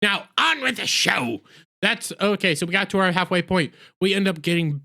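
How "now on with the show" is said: drawn-out, higher-pitched show intro; big "on with the show" announcement; show announcer. Pretend. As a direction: stretch it out like a host kicking off the show. Announcing return from ad break.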